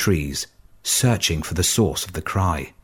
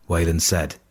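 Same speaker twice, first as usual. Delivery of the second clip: ordinary delivery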